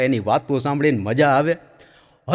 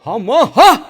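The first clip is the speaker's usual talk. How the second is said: high loud trance cry; hammed-up possessed woman's cry; possessed shriek; 8 semitones higher, 9 dB louder than usual.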